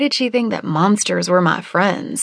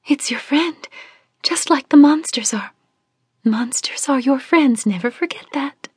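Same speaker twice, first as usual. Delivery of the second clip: high, dreamy whispered warning; otherworldly hushed insistence; eerie whisper; 8 semitones higher than usual. Acted. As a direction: half-whisper an odd prophecy. Celeste's whispered warning continues.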